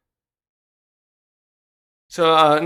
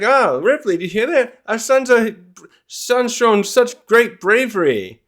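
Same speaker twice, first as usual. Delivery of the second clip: proud father, higher pitched; Scopper; 7 semitones higher, 4 dB louder than usual. Acted. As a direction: boast about a son to his mother. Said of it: "Scopper's like".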